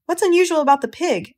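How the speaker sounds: high, loud surprised question; sharp, astonished asking; startled question. Acted. Wife asks, startled.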